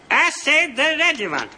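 high squeaky dummy voice; squeaky ventriloquist dummy. Acted. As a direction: pipe it up like a little puppet. Dummy's answer in demo.

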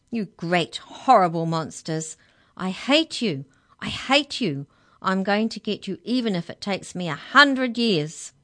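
loud, furious outburst; very angry shout. A narrator's reading. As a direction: hurl insults at full volume. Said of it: "a loud and very angry shout".

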